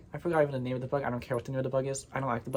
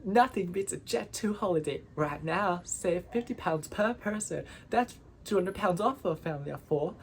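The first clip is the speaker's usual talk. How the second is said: higher-pitched British holiday-ad voice; perky British ad read, pitched up; British accent; 5 semitones higher than usual. Shown as a demonstration. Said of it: "I think I can do a British accent".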